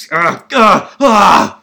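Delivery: loud, high groans of distress; miserable groans. Real, not acted. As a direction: let out loud exasperated "ugh"s. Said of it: "I feel awful".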